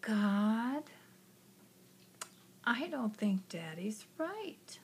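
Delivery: slow soft whisper to God; whispered prayer. Retold or imitated. Little girl in bed whispers to God. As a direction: pray it in a hushed bedtime whisper.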